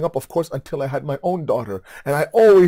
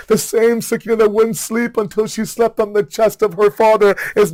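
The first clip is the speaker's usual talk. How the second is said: high, pleading mourning sermon; impassioned lament; 5 semitones higher, 4 dB louder than usual. Acted. Preacher telling mourners of Sakina's farewell to her father, impassioned lament. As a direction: raise the voice into a sustained, pleading lament.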